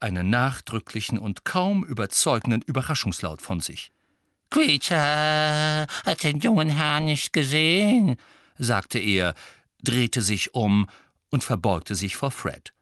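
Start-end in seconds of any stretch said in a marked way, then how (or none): 4.5-8.2 high, slow, drawn-out elf voice; servile house-elf. Acted. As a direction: stretch it out with exaggerated servility. Servile elf addresses young master. (unterwürfiger Hauself, hoch und gedehnt)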